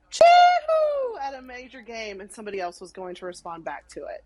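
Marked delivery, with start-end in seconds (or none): 0.1-1.2 very high, loud Polynesian celebratory whoop; piercingly high, full-voiced chee-hoo. Shown as a demonstration. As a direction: whoop a long chee-hoo across a stadium. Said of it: "I got to experience myself going".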